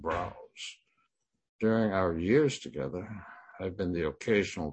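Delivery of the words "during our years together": ordinary delivery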